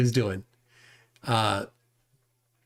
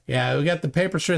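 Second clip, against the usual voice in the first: ordinary talk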